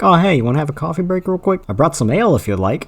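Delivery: quick, chatty aside, starting high; mock casual offer. Mocking a character pausing his task, in breezy quick chat. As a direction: toss it off fast and light, mock-friendly. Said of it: "and be like".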